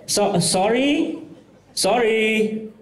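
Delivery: high, slow, drawn-out sorry; mock drunk apology. Comic imitating drunk heckler's slow high sorry. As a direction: play the drunk woman apologizing sweetly.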